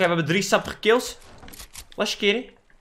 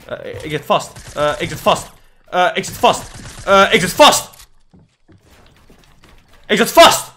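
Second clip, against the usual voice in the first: building from plain to loud; 5 dB louder than usual. Real, not acted. Stuck player calling out louder and louder to his team. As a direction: get more frantic with each try.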